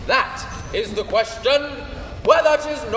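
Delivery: booming stage recitation of Hamlet; declaiming Shakespeare. Acted. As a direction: proclaim it to the rafters. [name] declaims Hamlet loudly.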